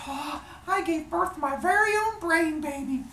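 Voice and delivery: very high, cooing proud parent; gushing delight. Acted. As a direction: gush in a hammed-up high voice. Zeus delighted by his brain baby.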